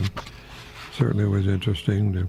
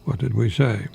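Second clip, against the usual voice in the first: ordinary delivery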